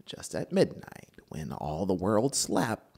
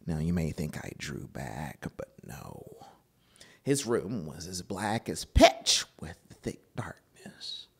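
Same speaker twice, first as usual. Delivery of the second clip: usual speaking voice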